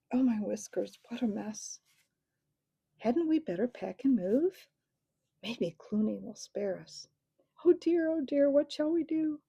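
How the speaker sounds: worried mice wringing paws; mild dithering fear; fretful mice. A narrator's reading. Frightened mice panicking.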